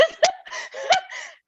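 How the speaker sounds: high laughing squeal; laughing and half crying at once; real laugh. Real, not acted. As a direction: break into a high helpless laugh. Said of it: "the most Korean thing".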